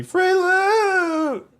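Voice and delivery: very high robotic sing-song call; auto-tune yell. Pretend. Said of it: "This needs to be a soundboard".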